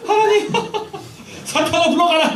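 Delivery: high, loud laughing cry to God; shouting in the car. Retold or imitated. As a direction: yell it out alone in the car, half laughing. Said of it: "막 하면서". (차 안에서 웃으며 크게 외치기)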